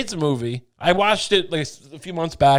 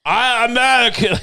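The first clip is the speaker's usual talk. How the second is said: higher, louder hammed-up foreigner claiming American accent; mock accented American; 8 semitones higher, 5 dB louder than usual. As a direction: parody a bad attempt at an American accent, loudly. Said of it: "with a believable American accent".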